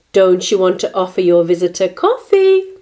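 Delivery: loud, pointed question; calling out. A narrator's reading. Grandmother prompts Martina to serve coffee, calling out. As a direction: project it firmly to the listener. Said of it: "called her abuelita".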